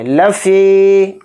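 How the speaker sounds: लफ़ी with the फ़ी vowel drawn out; लफ़ी chanted slowly, long final ee. Shown as a demonstration. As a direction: stretch the ee of लफ़ी. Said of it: "फ़ी को यहाँ थोड़ा खींचेंगे".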